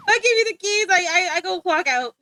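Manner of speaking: laughing giddily. Real, not acted. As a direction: burst out giggling at your own joke. Laughing, handing over the stream.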